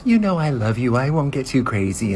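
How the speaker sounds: high cooing reassurance; high sugary promise; sweet wife voice. Retold or imitated. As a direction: lay on the sweetness. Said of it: "She's all like".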